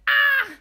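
loud, high yelp breaking into laughter; sudden high squeal, half laughing; mock scream. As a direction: let out a playful shriek. Imitates her own shocked reaction.

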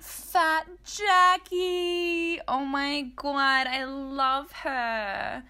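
very slow, drawn-out high gushing; adoring gush. Real, not acted. Host gushing to listeners about meeting someone she loves, drawn-out adoration. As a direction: stretch every word out in delight.

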